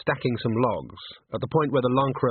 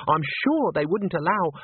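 high, airy trill; breezily reassure, voice lifted high; 6 semitones higher than usual. A narrator's reading. Christine reassures Agnes, trilling. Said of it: "trilled Christine".

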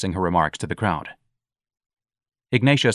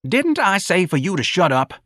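high, raised-voice irritation; angry policeman; 11 semitones higher, 4 dB louder than usual. A narrator's reading. Policeman silencing the old man, angry. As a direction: snap at them with raised pitch. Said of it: "the policeman said angrily".

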